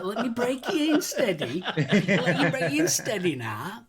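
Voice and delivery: joking through laughter; laughing. Real, not acted. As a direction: tease while cracking up. Joking innuendo while cracking up.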